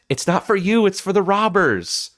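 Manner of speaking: high-pitched, hammed-up mother voice; mother's retort. Mother answering her son about the key, hammed-up retort. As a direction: act out the mother, pitched well up. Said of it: "She says".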